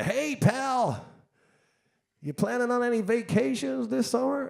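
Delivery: high, hailing mock Californian drawl; Californian billionaire accent. Billionaire greeting a drinker in an Irish bar, brash Californian accent. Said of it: "it's about a Californian billionaire".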